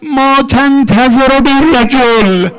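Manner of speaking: loud, drawn-out shouted order; full-force cry to an army; commander's shout. Acted. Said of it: "فریاد زد به سپاهش".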